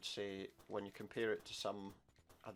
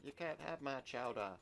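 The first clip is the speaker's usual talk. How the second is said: a light Boston accent, lightly put on; a playful hint of a Boston accent; 3 semitones higher than usual. Shown as a demonstration. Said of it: "like, a Boston accent".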